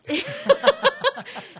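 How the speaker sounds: sudden high, loud laugh; loud burst of laughter. Real, not acted. Laughs at own memory.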